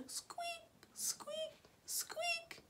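very high, soft squeaks; squeaking noise. A narrator's reading. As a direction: make a tiny squeaky sound. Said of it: "what this squeaking could be".

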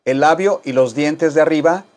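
ordinary delivery

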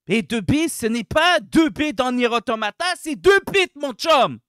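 loud, indignant rant; angry, emphatic correction; worked up. Real, not acted. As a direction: get heated and insist loudly. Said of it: "Désolé, je m'énerve".

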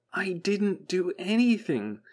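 high, slow, desperate cry; desperate unvoiced protest. A narrator's reading. Chell wishing the paranoid voice would believe her, desperate. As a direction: protest as if straining to scream. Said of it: "Chell would have screamed if she could".

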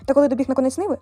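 ordinary delivery

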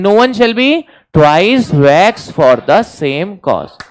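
very slow, measured chant for repeating; drawn-out, evenly spaced drilling of a maxim; slow recitation. Shown as a demonstration. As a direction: recite it word by word for the class. Dictating maxim for class to repeat.

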